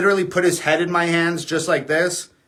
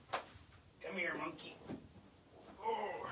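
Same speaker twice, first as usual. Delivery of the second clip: called from across the room, much softer; distant, softer coaxing to a pet; far off mic; 3 semitones higher, 25 dB quieter, slower than usual. Real, not acted. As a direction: step away from the mic and call the dog over. Walks off mic to grab dog.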